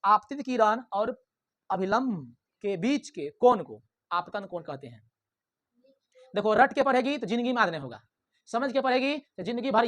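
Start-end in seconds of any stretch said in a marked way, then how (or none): 0.0-5.1 slow dictation pace. Shown as a demonstration. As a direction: dictate word by word as they write. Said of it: "लिखो". (धीरे धीरे लिखवाना)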